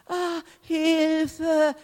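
high, drawn-out mock wail; half-hearted scream. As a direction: feebly imitate a cry for help. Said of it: "kannst du nicht schreien?".

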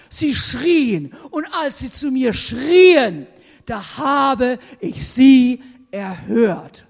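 loud, slowing preacher's declaration; booming scripture quote; emphatic proclamation. Acted. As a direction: proclaim each word with weight. Forceful quote of God's answer.

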